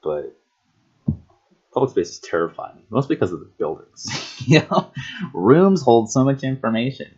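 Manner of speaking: normal talking voice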